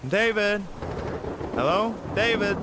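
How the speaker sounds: shouting a name through the house; loud call, pitched high to carry upstairs. Acted. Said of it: "Mom, why are you yelling?".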